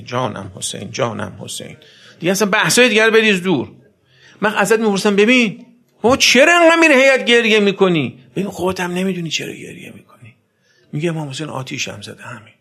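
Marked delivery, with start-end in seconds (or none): 0.0-1.8 soft, low devoted murmur of the name; lovingly repeated jānam Hosein; tender murmur. Pretend. 5.2-8.2 loud, high questioning call; loud challenge to a weeping mourner; challenging shout. Retold or imitated. Preacher voices questioner loudly. 11.1-12.5 hushed mourner's answer; soft confession. Retold or imitated.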